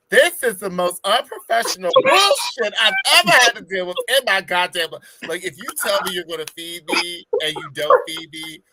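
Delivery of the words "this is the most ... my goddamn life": louder mock rant; mock outrage. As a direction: rant like a furious customer. Ranting about no food.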